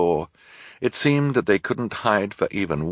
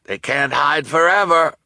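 high, loud call; crying out; 8 semitones higher, 5 dB louder than usual. Acted. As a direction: shout it to Lou. Shopkeeper calls out.

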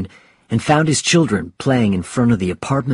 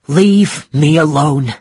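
slow, loud, raised angry order; higher, louder, drawn-out rebuff; 5 semitones higher, 5 dB louder, slower than usual. Acted. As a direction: push her away with slow, heated words. Hurt husband pushes wife away.

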